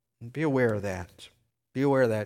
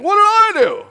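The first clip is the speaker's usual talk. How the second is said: much higher, louder wronged whine; high puzzled dog voice; 15 dB louder than usual. Retold or imitated. Preacher voicing the dog wronged in the family fight, high puzzled whine. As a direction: squeal it like a confused pet. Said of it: "It's like".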